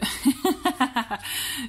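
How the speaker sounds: real burst of giggling laughter; amused laugh. Real, not acted. Reader recognising her own challenge card, amused laugh. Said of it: "je rigole".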